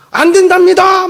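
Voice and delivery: high, loud exasperated complaint; exasperated report. Retold or imitated. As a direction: whine it up to the commander. Drill instructor reports refusal.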